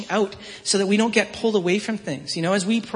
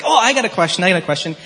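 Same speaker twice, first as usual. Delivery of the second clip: put-on eager kid; 3 semitones higher, 8 dB louder, faster than usual. Dad retells son butting in, excited. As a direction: jump in like an excited three-year-old.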